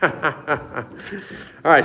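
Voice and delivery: laughing. Real, not acted. Laughs at going wrong floor.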